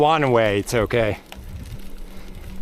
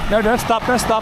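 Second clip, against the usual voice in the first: urgent call; 8 semitones higher than usual. Real, not acted. Urging driver, calling out.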